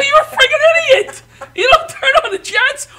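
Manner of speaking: high, loud laughing yell; exasperated yell. Retold or imitated. Son yelling at father in the whirlpool, loud and high. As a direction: yell at your father in disbelief. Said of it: "I go".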